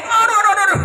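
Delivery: high squealing cries of pain; monkey crying out. Acted. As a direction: yelp like a hurt little monkey. Monkey falls from tree. (jeritan monyet kesakitan, suara tinggi)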